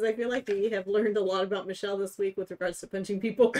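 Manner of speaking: reading aloud through laughter; laughing. Real, not acted. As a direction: giggle while you read it. Reading a funny chat comment.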